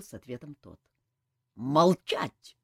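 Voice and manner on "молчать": loud bark of silence. A narrator's reading. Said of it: "снова рявкнул полковник". (громкий рявк)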